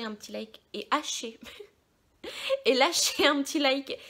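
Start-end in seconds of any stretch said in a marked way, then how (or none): 2.2-4.0 laughing. Real, not acted. Laughing after tongue slip.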